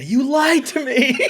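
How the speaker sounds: slow, high, laughing mock betrayal; a hammed-up wounded cry through laughter; playful fake outrage, slow and high; betrayed guest. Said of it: "And then I'll say".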